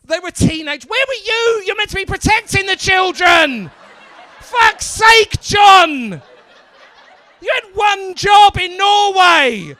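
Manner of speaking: high, loud exasperated scolding; incredulous comic rant, yelled high; mock outrage. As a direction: shout it like a hammed-up telling-off. Mock scolding of audience member.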